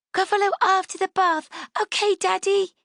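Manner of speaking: put-on high, excited child's plea; little girl voice. Daughter bargains with daddy.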